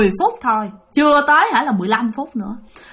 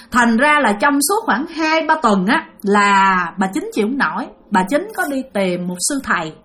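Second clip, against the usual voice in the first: ordinary delivery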